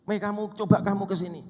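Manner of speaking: loud, high, barking summons to the wife; angry husband. Retold or imitated. Husband angrily calling his wife over. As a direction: call her over like a furious husband.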